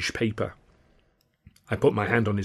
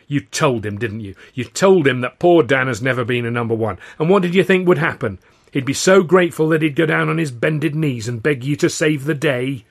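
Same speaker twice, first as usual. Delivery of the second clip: raised, louder scolding; exasperated telling-off, pitched up; berating; 4 semitones higher, 6 dB louder than usual. A narrator's reading. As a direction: heap sarcastic blame on them. Narrator berates Hurley for blunder.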